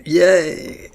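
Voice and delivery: high cheer into laughter; excited cheer. Real, not acted. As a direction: squeal with joy and laugh. Happy at correct guess.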